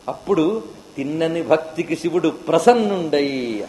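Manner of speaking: ordinary talk, nothing marked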